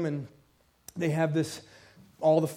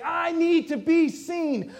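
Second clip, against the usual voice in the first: much higher, slow, pleading voice; hurting plea; 10 semitones higher, 4 dB louder, much slower than usual. Retold or imitated. Hurting person pleading with the church to notice them. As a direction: ask to be noticed, aching and slow. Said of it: "someone is at this moment of saying".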